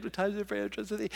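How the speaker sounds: fast, soft, panicked rattling of the verse; boy in bed reciting the verse, fast and soft; frightened rapid recitation. Retold or imitated. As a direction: gabble it under your breath like a scared kid. Terrified boy hearing sounds.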